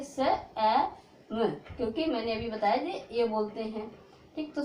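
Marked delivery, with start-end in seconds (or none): 0.0-1.5 से ए म, one sound at a time. Shown as a demonstration. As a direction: split सेम into से, ए, म, leaving the last e silent. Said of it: "ई को नहीं बोलेंगे". (से ए म, अलग-अलग आवाज़ों में तोड़कर)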